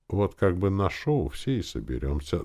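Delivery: ordinary delivery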